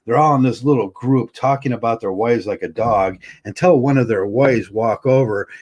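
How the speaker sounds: ordinary talk, nothing marked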